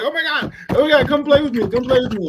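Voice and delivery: high, loud, bouncing eager begging; acting like a dog greeting its owner, squealing eagerly; excited puppy. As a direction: yip it like an overexcited puppy. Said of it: "like one of them dogs that be like".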